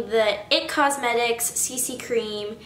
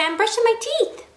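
a light high voice for the dog; voicing the dog; 11 semitones higher than usual.

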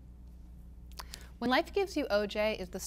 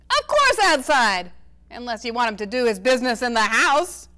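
exasperated grandpa; 3 semitones higher, 10 dB louder than usual. A narrator's reading. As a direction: throw up your hands at her. Grandpa Ace voiced in reading.

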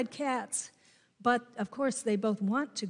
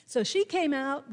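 ordinary talk

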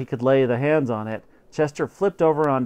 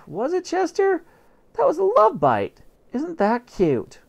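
high doting coo; high sugary mother voice; cooing mom. Acted. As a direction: sweet-talk the cat. Mother coos at the cat.

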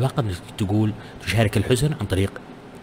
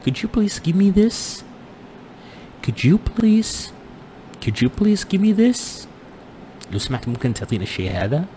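polite request; 9 semitones higher, slower than usual. Shown as a demonstration. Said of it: "من اللباقة تقول".

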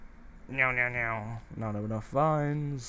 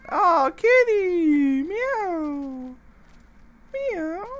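very high, slow meowing at a cat; squeaky kitty call; 7 dB louder, much slower than usual. Pretend. Calling a cat in the game.

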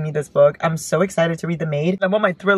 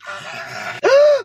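very high delighted gasp. Real, not acted. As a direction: gasp with joy. Sees another song she loves.

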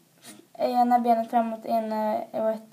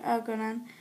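plain reading voice

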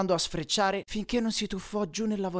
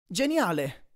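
plain reading voice

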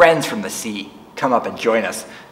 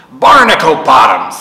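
grumble; 3 semitones higher, 8 dB louder than usual. Acted. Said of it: "Swashby muttered".

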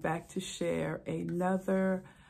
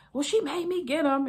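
fussing husband; 9 semitones higher, 5 dB louder than usual. Retold or imitated.

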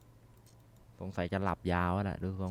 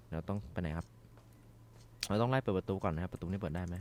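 normal talking voice

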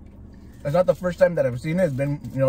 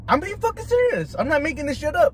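insistent; 7 semitones higher than usual. Real, not acted. Insisting he is serious.

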